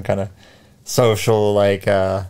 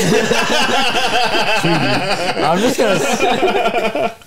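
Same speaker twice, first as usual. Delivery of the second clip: high, very slow laughing drawl; 11 semitones higher, much slower than usual. Real, not acted. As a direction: crack up mid-word. Laughing at the odd word.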